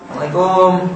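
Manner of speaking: "Assalamualaikum" quick and clipped like "Selamat pagi"; greeting intonation. Shown as a demonstration. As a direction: toss off "Assalamualaikum" as a casual hello. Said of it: "Sama kan?". (salam dengan nada sapaan)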